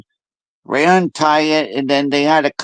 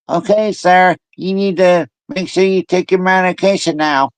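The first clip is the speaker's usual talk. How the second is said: higher sing-song orders trailing into da-da-da; imitating nagging nurse; 4 semitones higher than usual. Nurse telling a patient about medication, mock nagging drone. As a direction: mimic a nurse droning instructions. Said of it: "she was in there going".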